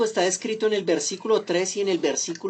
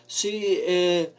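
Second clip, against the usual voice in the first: slow, put-on Mexican singer's voice, lightly done; a preacher hamming up a Mexican accent, louder and slow; mild mock Mexican accent; 3 semitones higher, 4 dB louder, much slower than usual. Preacher mocks musicians imitating [name], light Mexican accent. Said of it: "hacían una especie de voz mexicana".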